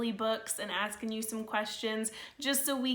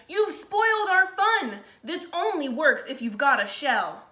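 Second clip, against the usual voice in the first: louder, annoyed telling-off; scolding snails; 5 semitones higher, 5 dB louder than usual. Acted. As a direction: scold him for spoiling the game. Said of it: "they cried".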